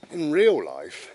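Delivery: slow, laughing "in real life". Real, not acted. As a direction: laugh through the words in real life, slowly.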